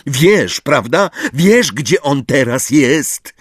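high, pressing accusation; shouted insistence. A narrator's reading. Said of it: "krzyknął Harry". (natarczywy, podniesiony głos)

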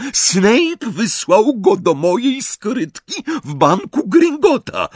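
high, slow, emphatic accusation; agitated accusation. A narrator's reading. Bellatrix confronts werewolf about her vault, agitated. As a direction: spell it out in high-pitched alarm. Bellatrix alarmed, pressing the captive.

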